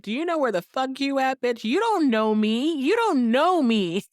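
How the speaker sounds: hammed-up Suzanne losing it; mock meltdown yell. Suzanne's full-out meltdown at Alex.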